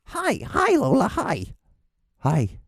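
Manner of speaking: very high squealed greeting to a dog; excited pet talk. Real, not acted. Greeting an excited little dog, high squealing pet talk.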